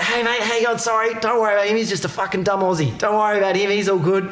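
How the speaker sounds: high, chummy mate voice to the cop; mock friendly Aussie charm; imitating Dukes. Dukes sweet-talking the cop.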